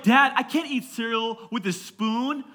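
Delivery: slow, high imitation of a fussy kid; picky child. Daughter refusing a spoon for cereal, picky child. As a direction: drag it out like a complaining child.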